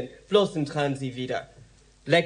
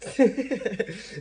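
short scornful laugh at the cop; mocking laugh; 5 semitones higher, 3 dB quieter than usual. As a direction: laugh him off with contempt. She laughs at the cop's remark.

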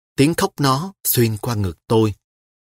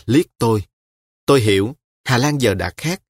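ordinary delivery

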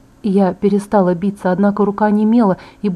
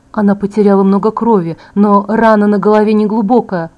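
ordinary delivery

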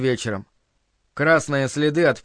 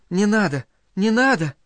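high-pitched acted plea; pleading through tears; 5 semitones higher than usual. A narrator's reading. Boy begs the woman beating Linda, tearful plea. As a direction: plead to stop, voice lifted. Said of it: "Он, плача, стал просить бьющую".

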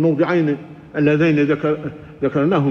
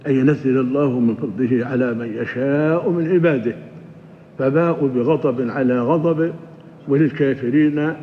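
lower, steady recitation of the verse; Quran recitation; 4 semitones lower than usual. Acted. (تلاوة خاشعة للآية بصوت أخفض)

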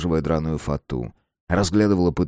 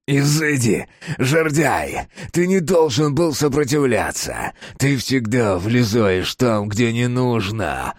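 higher, strained scolding; accusing, raised-pitch outburst; angry cry; 5 semitones higher, slower than usual. A narrator's reading. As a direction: berate him in a possessed voice. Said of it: "закричал Капустин страшным, чужим голосом".

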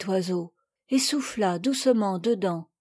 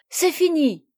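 higher, proudly announced exclamation; triumphant cry; 5 semitones higher than usual. A narrator's reading. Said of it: "se retournant triomphalement, elle s'écria". (cri triomphal)

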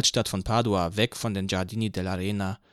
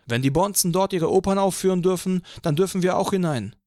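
put-on higher, louder indignant young fan; 9 semitones higher, 6 dB louder than usual. A narrator's reading. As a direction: rail against the big shots. Crowd member ranting about opera.

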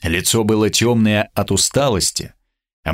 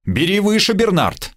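lofty, much higher declamation; grandiloquent; 12 semitones higher than usual. Acted. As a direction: proclaim it grandly, like a herald. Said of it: "ответил я высокопарно". (высокопарно, торжественно)

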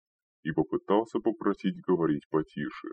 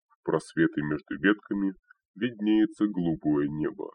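usual speaking voice

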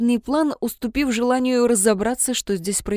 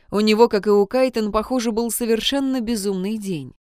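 usual speaking voice

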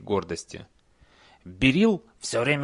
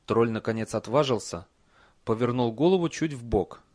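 usual speaking voice

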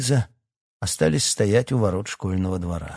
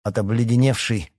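ordinary delivery, nothing marked

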